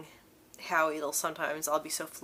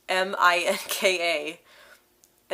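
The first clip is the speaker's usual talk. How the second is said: slow, louder, letter by letter; spelled out slowly; 8 dB louder, much slower than usual. Retold or imitated. Spelling her name for the barista, slow and deliberate.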